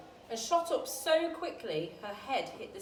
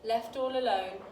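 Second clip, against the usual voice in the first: plain reading voice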